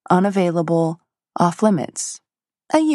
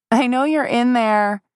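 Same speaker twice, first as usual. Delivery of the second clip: calling through the door; 7 semitones higher than usual. Acted. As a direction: call out to him inside. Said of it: "she called".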